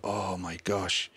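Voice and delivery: relieved sigh. Retold or imitated. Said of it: "I was like".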